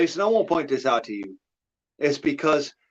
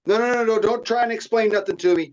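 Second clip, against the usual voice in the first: high, louder string of refusals; cutting off excuses; 9 semitones higher, 4 dB louder than usual. Acted. Boss to lawyer being fired, cutting off excuses. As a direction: shut down the lawyer's explanation before it starts. Acting out firing his lawyer.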